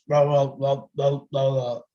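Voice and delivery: a hint of grandpa grumbling; mumbling old grandfather. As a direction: mumble it like an old man nobody can understand. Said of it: "The only thing my grandfather said to me was".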